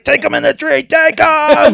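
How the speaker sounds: very high, loud frantic urging; Jim's excited shout. Retold or imitated. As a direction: shout it like an over-excited hunting buddy. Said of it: "Jim's like".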